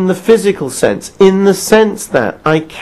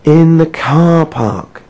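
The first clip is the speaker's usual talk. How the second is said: incredulous; much slower than usual. Real, not acted. Incredulous echo of the caller.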